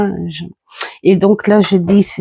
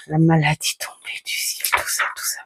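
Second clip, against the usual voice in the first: whispering; 10 dB quieter, much faster than usual. Real, not acted. Showing book pages, hushed.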